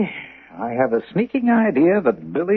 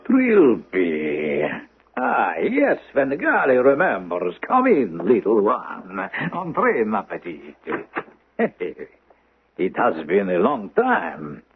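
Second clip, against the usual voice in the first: Svengali; 7 semitones lower than usual. Acted. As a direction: draw her in, savouring each word. Svengali greets Trilby with French.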